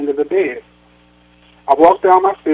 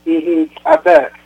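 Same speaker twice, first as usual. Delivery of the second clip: slow, high, drawn-out sarcastic mm-hmm; mother doubting her daughter's happy husband, sarcastic drawl; 13 semitones higher, slower than usual. A narrator's reading. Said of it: "another sarcastic remark flew out".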